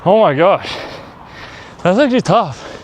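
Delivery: panting after a jog, pitch jumping up; out of breath. Real, not acted. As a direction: gasp the words out, winded. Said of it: "I'm, like, running out of breath".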